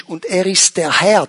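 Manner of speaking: ordinary talk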